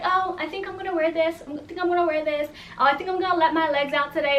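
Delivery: chill friend impression. Friend choosing what to wear while others stress, mock-carefree. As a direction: imitate her idly picking an outfit. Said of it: "Jamila is chill".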